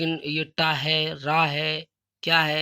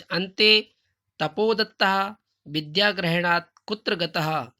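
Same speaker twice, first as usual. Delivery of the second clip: slow loud recitation; 3 semitones higher, 6 dB louder, slower than usual. Shown as a demonstration. Teacher recites Sanskrit question.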